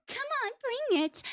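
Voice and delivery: high sassy taunt; sassy Peach. Retold or imitated. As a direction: taunt with regal sass. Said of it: "that absolute sassy".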